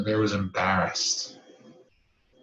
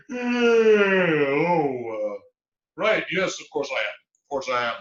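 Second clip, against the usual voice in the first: higher, louder, flustered and half awake; startled bear; 4 semitones higher, 8 dB louder, slower than usual. Acted. Groggy bear startled awake, covering her confusion. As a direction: caught out, bluff your way through it.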